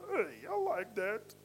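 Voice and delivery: slow, odd cartoon character voice; drawn-out cartoon figure. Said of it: "different voices".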